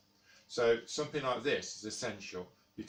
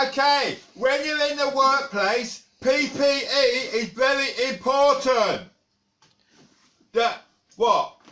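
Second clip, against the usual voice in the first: much higher, much louder, slow announcing; shouting; 14 dB louder, slower than usual. Acted. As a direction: bellow it like you can't hear yourself. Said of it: "Don't need to shout, dude".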